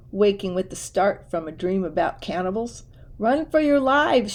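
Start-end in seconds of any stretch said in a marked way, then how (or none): 3.2-4.3 higher, louder panicked cry; shrieking. A narrator's reading. Cousin waking the house in panic, mild shriek. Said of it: "shrieked Cousin Elwood".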